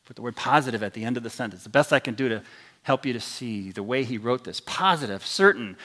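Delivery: ordinary delivery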